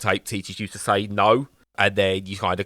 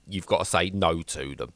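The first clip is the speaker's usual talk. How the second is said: ordinary talk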